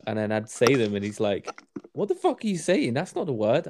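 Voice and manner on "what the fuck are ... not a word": high-pitched mock incredulous reaction; baffled mate. Mate correcting a learner's botched Portuguese, mock incredulous. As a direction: imitate a mate's exasperated disbelief, pitched up. Retells mate's baffled scolding.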